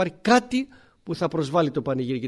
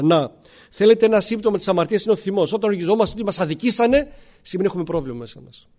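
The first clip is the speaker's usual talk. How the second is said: usual speaking voice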